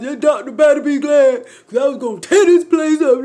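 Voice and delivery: loud, high, worked-up threat; heated retold ranting; husband's angry rant. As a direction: imitate an upset man going off on someone. Voicing upset husband threatening doctor.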